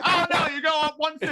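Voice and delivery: high, fast yelled directions; high pitched hollered directions; mock crowd yelling. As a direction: shout directions like a local crowd. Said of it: "local crowd yelling at her".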